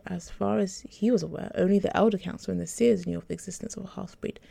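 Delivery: plain reading voice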